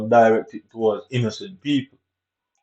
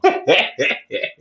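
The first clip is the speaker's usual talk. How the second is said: short laugh; 6 semitones higher than usual. Real, not acted. Bitter laugh after own rant.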